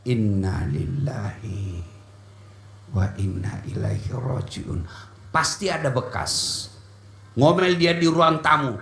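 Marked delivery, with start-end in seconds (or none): 0.1-4.9 much lower, slow, deeply felt recital; solemn recitation. Shown as a demonstration. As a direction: recite it low and slow, hand on chest. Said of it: "Ucapkan innalillah, lalu usap dadamu". (bacaan khusyuk sambil mengusap dada)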